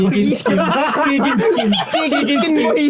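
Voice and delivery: high-pitched, helpless laughter through the words; laughing. Real, not acted. Friends joking about what becomes of the skin, laughing. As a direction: crack up while blurting out the joke.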